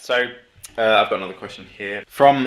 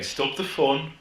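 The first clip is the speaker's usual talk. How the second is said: mock killjoy in a high Midlands accent; high-pitched Midlands parody voice; put-on Midlands accent; 4 semitones higher, 4 dB quieter, much slower than usual. Officials banning sparklers, put-on Midlands accent. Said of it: "Good accent, yeah".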